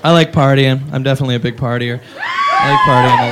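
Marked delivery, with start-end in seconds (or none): 2.2-3.3 very high drawn-out shout; party shout. Acted. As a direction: yell it like a wild partier.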